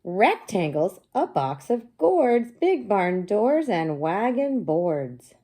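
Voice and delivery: high, loud, slow storybook read-aloud; sing-song read-aloud for young kids; reading to children. Acted. Teacher reading the book aloud to her class, slow and bright.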